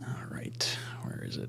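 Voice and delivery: whispered to himself; whispering. Real, not acted. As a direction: breathe it with no voice. Muttering while searching.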